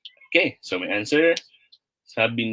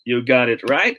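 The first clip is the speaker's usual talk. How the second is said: normal talking voice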